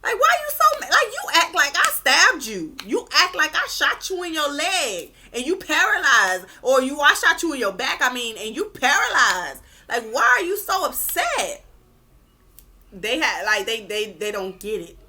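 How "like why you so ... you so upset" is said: much higher, loud mocking whine; loud high-pitched mockery of an overreacting victim; mocking narcissist. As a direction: play the guilt-free friend brushing off your pain. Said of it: "They'll be looking at you like".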